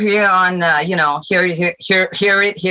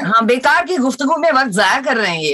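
fast, higher, playful Urdu; mock formal Urdu; 7 semitones higher, much faster than usual. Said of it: "I'll just be American".

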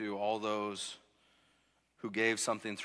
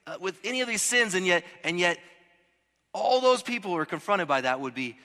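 higher, louder preaching climb; full-force preaching; 9 semitones higher, 9 dB louder than usual. Acted. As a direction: preach it out with rising fire. Preacher building to a peak.

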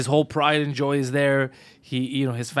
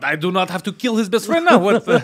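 raised, mock-indignant protest; Khabib impression; 6 semitones higher, 5 dB louder than usual. Fighter defending himself over insulted father, mock indignant. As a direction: argue as the wronged party, laughing a little. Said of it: "He's like".

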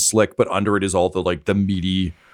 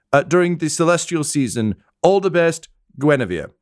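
reading a note; 7 semitones higher than usual. Acted. Worker reads out a note.

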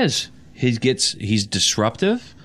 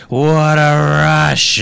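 loud, stretched-out wrestler roar; wrestler impression; 3 semitones higher, 6 dB louder, much slower than usual. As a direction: bellow it slowly like a wrestling catchphrase. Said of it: "It's like the wrestlers".

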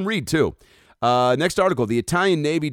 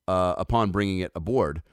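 ordinary talk, nothing marked

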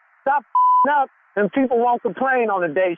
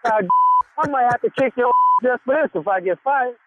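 ordinary talk

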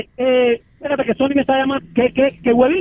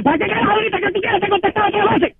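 annoyed wife; 8 semitones higher than usual. Acted. Annoyed wife snaps back.

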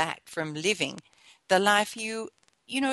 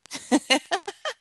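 light, higher-pitched chuckle; 9 semitones higher, 3 dB louder than usual. Real, not acted. Storyteller after her golf joke, real chuckle. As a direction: chuckle at your own punchline.

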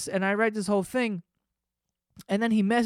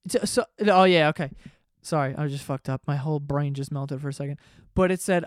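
ordinary delivery

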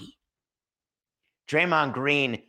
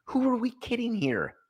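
incredulous; 11 semitones higher than usual. Real, not acted.

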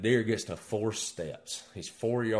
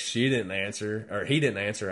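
normal talking voice

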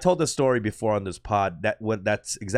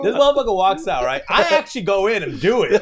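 laughing; 7 semitones higher, 6 dB louder than usual. Real, not acted. Laughing retelling.

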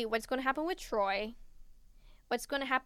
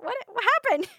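high, loud, baffled question; acting out confused surprise; baffled reaction; 8 semitones higher, 8 dB louder, faster than usual. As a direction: blurt it out, totally lost. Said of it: "I read that, and I'm like".

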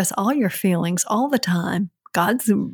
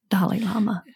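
whisper. Real, not acted. Closing the quote, whispering its author's name. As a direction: breathe the name reverently.

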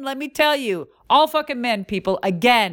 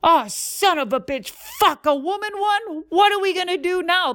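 higher, exasperated voice of the owner; mock outraged owner; 8 semitones higher than usual. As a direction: imitate the appalled executive, hammed up. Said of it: "he goes".

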